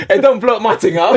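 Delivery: loud laughing protest. Real, not acted.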